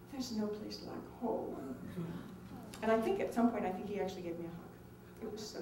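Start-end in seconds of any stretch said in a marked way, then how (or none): 0.1-1.8 tender quote. Retold or imitated. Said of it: "he turned to everyone and he said".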